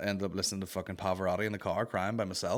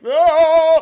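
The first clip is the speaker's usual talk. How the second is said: loud, high sustained tenor wail; belted opera "Ah!"; mock operatic scream; 17 dB louder than usual. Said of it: "like, screaming in the air".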